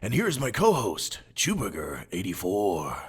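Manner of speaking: put-on podcast host voice with swooping pitch; Big Roy. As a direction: play the show's host, drawing out the line endings. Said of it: "I'm Big Roy".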